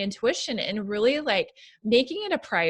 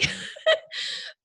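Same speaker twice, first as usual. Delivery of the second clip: short tearful sob-laugh; teary sob-laugh; 4 dB quieter than usual. Real, not acted. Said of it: "Sorry, I'm gonna teary".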